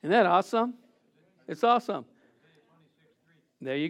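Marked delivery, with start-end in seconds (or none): none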